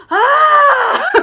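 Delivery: long, high, loud scream; freaked-out scream. Acted. Vampire back on Earth missing an eyeball, screaming. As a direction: let out a hammed-up shriek of panic. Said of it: "he's freaking out".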